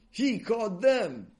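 "He caught them" drawn out, high and loud; triumphant reversal. A narrator's reading. Triumphant reversal after calm reassurance.

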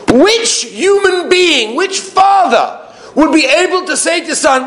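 high, drawn-out rhetorical question; impassioned preaching. Real, not acted. Rabbi challenging every father listening, impassioned preaching. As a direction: demand an answer from the congregation.

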